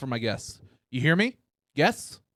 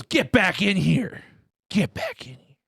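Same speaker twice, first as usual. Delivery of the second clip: menacing order; 5 semitones higher, slower than usual. Retold or imitated. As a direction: drag each word out like a warning. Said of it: "and goes".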